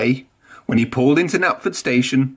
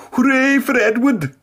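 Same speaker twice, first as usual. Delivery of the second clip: much higher, loud happy cheer; cheering cry; 11 semitones higher, 3 dB louder than usual. A narrator's reading. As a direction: cheer a friend on from the junction. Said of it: "cried Donald".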